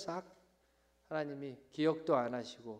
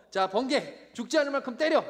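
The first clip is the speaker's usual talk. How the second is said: high, loud mock order; hammed-up angry God calling down lightning; wrathful command; 8 semitones higher, 7 dB louder than usual. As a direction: bark out a comic divine punishment.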